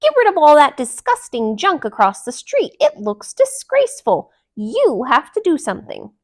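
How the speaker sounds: loud high bossy order; demanding. A narrator's reading. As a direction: order him about. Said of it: "demanded Delilah".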